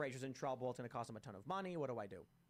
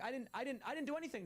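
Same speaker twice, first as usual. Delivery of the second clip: a hint of high, stammering protest; lightly voiced whiny denial; protesting guy; 10 semitones higher, faster than usual. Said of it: "And the guy's like".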